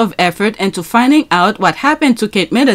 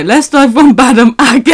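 ordinary delivery

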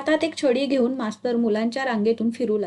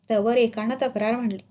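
ordinary delivery

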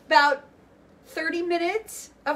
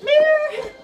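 very high, loud meow; loud cat meow; 12 semitones higher, 7 dB louder than usual. Pretend. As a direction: meow out loud to get the kids going. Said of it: "Meow, make your noises".

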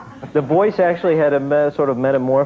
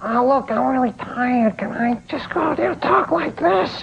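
Julie Kavner; 12 semitones higher than usual. Retold or imitated. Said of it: "And Julie said".

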